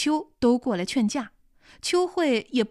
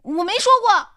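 loud denial; 8 semitones higher, 7 dB louder than usual. Acted. Accused denies the rumor.

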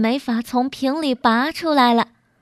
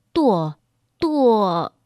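hesitant, drawn-out falling word; reluctant trailing repetition; faltering, unable to finish. A narrator's reading. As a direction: say it heavily, stuck before the next word. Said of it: "到底说不出个首字来".

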